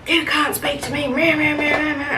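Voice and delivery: sneering imitation of an entitled customer; mocking customer voice. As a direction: parody a whining customer. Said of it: "And he's like".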